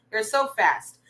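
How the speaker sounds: normal talking voice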